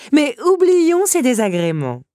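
high, loud, drawn-out hostess flourish; breezy countess. Acted. Countess changing the subject for her guest, airily theatrical. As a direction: sweep the trouble grandly aside.

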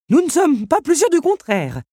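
high, loud insisting clock voice; insistent clock. A narrator's reading. Big Ben insisting to the Beast, high and loud. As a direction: press the point as the fussy clock. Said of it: "insista Big Ben".

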